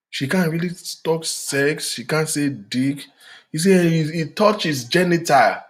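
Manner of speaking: ordinary talk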